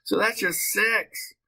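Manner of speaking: high, drawn-out excitement; slow it down but keep the pitch up, thrilled; excited. Real, not acted. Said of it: "I got all excited".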